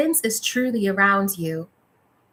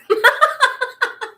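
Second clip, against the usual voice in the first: big amused laugh, pitched up; high burst of laughter; 13 semitones higher, 6 dB louder than usual. Real, not acted. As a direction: let out a bright, high laugh. Laughs at guides' reply.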